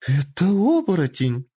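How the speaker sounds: high, loud cry of wonder; delighted exclamation. Acted. Lord seeing the fox change shape, delighted exclamation. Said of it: "восхищённо воскликнул он".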